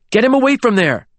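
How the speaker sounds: high, frantic order; urgent command. A narrator's reading. Philippa wants James away from the edge, urgent. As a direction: push them to act now.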